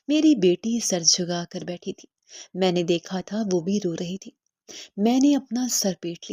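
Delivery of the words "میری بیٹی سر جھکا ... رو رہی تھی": plain reading voice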